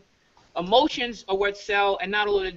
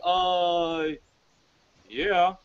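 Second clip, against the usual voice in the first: unsure answer; 3 semitones lower than usual. Shown as a demonstration. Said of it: "you sound like".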